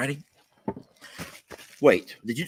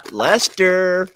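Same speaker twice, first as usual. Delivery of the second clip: reading a joke aloud; 4 semitones higher, 6 dB louder, much slower than usual. Retold or imitated. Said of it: "[name] continues with the jokes".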